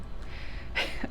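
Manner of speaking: a quiet teary laugh of relief; a small choked laugh; soft breathy laugh. Real, not acted. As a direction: laugh softly through emotion. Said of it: "I'll get emotional again".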